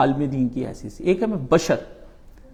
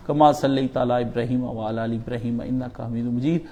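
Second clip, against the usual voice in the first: Arabic prayer recitation; 2 semitones lower, 4 dB quieter than usual. Real, not acted. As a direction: recite the Arabic supplications in a steady, softened flow. (عربی دعا کی دھیمی تلاوت)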